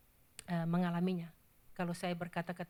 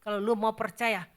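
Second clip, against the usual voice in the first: antichrist threat; 11 dB louder than usual. Retold or imitated. Preacher voicing the antichrist threatening believers, menacing. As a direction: threaten like a thug with a knife. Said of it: "Antikris bilang".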